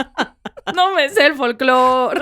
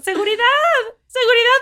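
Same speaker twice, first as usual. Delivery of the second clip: mock yell; 9 semitones higher, faster than usual. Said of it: "empiezan a gritar".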